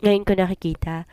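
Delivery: usual speaking voice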